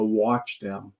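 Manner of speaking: lightly imitated raspy whisper of a young woman; a hint of a raspy ghostly woman's voice. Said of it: "you could hear a young woman's raspy voice go".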